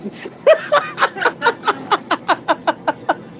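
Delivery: 1 giddy high laughing fit. Real, not acted. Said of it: "I'm getting silly about now".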